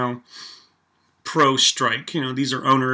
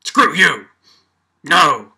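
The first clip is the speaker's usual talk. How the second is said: mock defiant yell; 7 dB louder, slower than usual. Vlogger mocking China's veto at the UN, shouting. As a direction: bark it back like a defiant nation. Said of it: "And then for China to be like".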